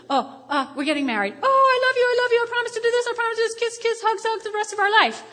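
high, loud, rushed lovey-dovey voice; mock newlywed promising love forever; gushing newlyweds. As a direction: gush like a smitten bride.

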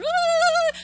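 high, loud happy squeal; excited squeal. Retold or imitated. The kids back from the mall, a high excited squeal. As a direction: squeal like a thrilled child.

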